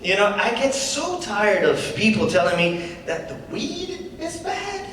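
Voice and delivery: lightly voiced comedy-club patter; stand-up comic. [name] opening his set to the crowd, casual comic patter. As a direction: work the crowd with an easy opener.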